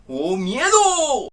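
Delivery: slow, high, drawn-out horror wail; dramatic horror cry. Acted. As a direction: stretch it like a horror host. Dramatic intro exclamation. (exclamación dramática de terror)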